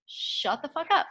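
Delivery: mock stunned friend hearing big news; disbelieving shock. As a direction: react like the news can't be true. Shocked reply to Sam's news.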